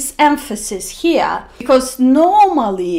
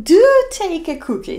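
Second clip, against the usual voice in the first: higher, "do" stressed; insistent host; 7 semitones higher than usual. Shown as a demonstration. Good host insisting a guest take a cookie. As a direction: press the cookie on them, leaning on "do". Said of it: "somebody is insisting".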